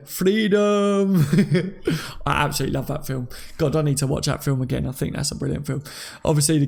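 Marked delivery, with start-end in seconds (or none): 0.1-1.2 high, held battle cry; mock rallying yell, long drawn vowel; Robbo Wallace. Fan hamming up a favourite film line, mock battle cry. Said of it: "Robbo Wallace".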